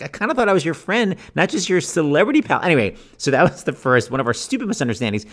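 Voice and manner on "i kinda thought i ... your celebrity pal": lightly voiced wounded friend, pitched higher; a touch of hurt reproach, higher; mildly offended friend.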